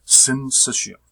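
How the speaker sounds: "syncytium" said firmly as sin-SISH-ee-um, for repeating; careful pronunciation demo. Shown as a demonstration. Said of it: "this is the way you pronounce it".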